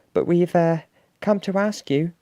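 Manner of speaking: high, hesitant little voice; very small voice. A narrator's reading. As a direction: falter politely in a small creature's voice. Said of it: "in a very small voice".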